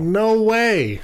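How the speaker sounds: high, loud no way; real shock at the news; high, loud refusal to believe it; disbelief. Real, not acted. Disbelief that Chris Evans is Bostonian.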